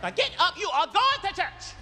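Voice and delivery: high, slow, emphatic mom order; strict mother. Retold or imitated. Mother gets kids up for church, strict. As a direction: rouse the kids in a high, insistent voice.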